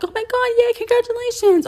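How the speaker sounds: high, loud gushing congratulations; excited family. Retold or imitated. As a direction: gush like a thrilled relative. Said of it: "they're like".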